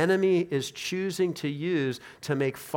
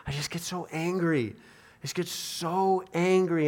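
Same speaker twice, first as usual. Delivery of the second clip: frustrated confession; 4 semitones higher than usual. Acted. Believer confessing anger they cannot overcome, frustrated.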